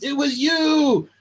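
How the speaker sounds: high, slow accusing cry; a hammed-up accusing shriek; mock accusation. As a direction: point the finger, drawn out and high. Imagined accusation from stranger.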